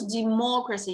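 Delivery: usual speaking voice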